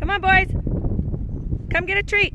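high, loud call to the animals. Real, not acted. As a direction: holler them over for a treat. Calling the alpacas over.

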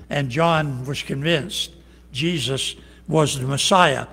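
ordinary delivery

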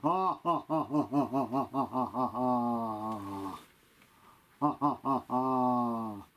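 very low monster laugh; deep evil laugh. Acted. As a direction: rumble a slow demonic laugh. Puppet villain laughs back.

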